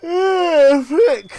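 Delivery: loud, high frustrated yelp; frustrated outburst. Real, not acted. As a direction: let the annoyance burst out. Frustrated at the game.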